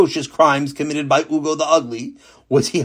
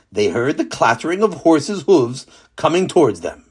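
ordinary delivery, nothing marked

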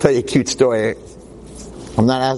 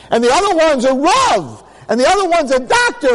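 hammed-up high, strained boasting; boastful proclaiming; 14 semitones higher than usual. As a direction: crow proudly at the top of your register. Old man excitedly boasting, quoted.

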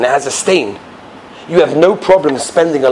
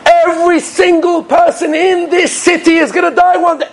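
fiery maggid preaching; 13 semitones higher than usual. Acted. Maggid warning villagers they will die, wailing sermon. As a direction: warn the whole crowd with stretched-out cries. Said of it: "And he goes again".

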